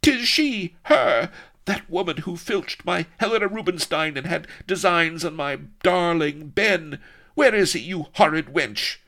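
high, loud indignant heroine; astonished outrage. A narrator's reading. Vanity spots her rival Sheba, astonished. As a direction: yelp it out in astonishment. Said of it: "could not suppress a yip of astonishment".

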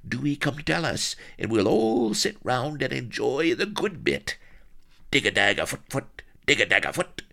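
high, loud country yokel rambling; agitated rustic Solomon. Acted. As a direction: chatter excitedly in a rustic voice. Solomon quotes the natives, agitated.

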